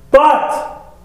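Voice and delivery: "but" popped out high and sudden; emphatic "but". Retold or imitated. Deacons about to contradict their praise, emphatic high "but". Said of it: "whenever somebody says the word "but".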